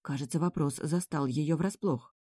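ordinary delivery

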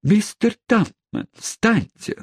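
very high, startled lady voice; exclaiming. Acted. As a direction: cry out in flustered alarm. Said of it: "воскликнула Рэйчел".

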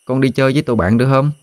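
put-on high, quick small child voice; murmuring child's plea. A narrator's reading. Child begs mother to go play, small high plea. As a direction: plead high without raising the voice. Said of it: "Đứa nhóc thì thào nói".

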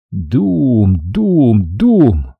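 booming "doom" three times; spoken shell bursts, evenly spaced; imitated explosions. A narrator's reading. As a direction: voice each blast as a long falling boom. Narrator imitates grenade explosions.

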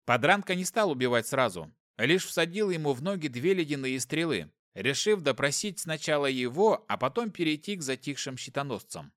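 plain reading voice

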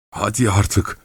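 usual speaking voice

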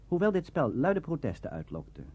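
normal talking voice

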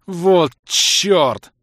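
loud, high howl of dismay. A narrator's reading. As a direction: howl it at full voice. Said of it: "взвыл я во весь голос".

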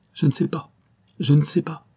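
panicked denial. A narrator's reading. Clerk panicking before minister.

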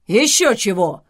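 loud roaring refusal. A narrator's reading. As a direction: roar it out indignantly. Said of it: "взревел Зевс". (взреветь в ответ)

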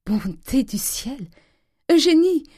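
high cry of delight; joyful cry. A narrator's reading. Mother calling Eugénie, joyful cry. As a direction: build from drawn-out wonder to a loud call. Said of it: "cria la mère en rougissant de joie".

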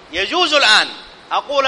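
normal talking voice

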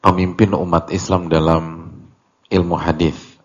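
ordinary delivery

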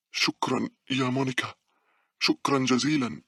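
high, effortful thanks; out of breath. A narrator's reading. Girl fallen aboard thanks her friend, out of breath. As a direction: thank as if winded from running. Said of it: "تحدثت باولا وهي تتنفس بصعوبة".

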